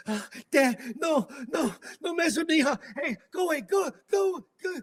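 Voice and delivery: high, loud, panicked screaming; frightened girl shrieking in a nightmare; terrified cries. Retold or imitated. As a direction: scream like she's fighting off a ghost.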